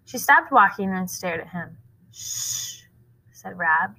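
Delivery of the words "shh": unvoiced shushing hiss; hushing shh. A narrator's reading. Rob hushing Sistine in the woods, whispered shh. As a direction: shush her so the landowner won't hear.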